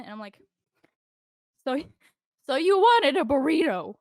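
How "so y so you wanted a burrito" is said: loud, high, over-enunciated acted rant; exasperated. Server explaining quesadillas to a stubborn customer, exasperated. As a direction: lay it out forcefully for someone who will not get it.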